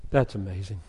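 usual speaking voice

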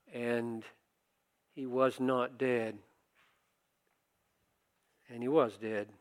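ordinary talk

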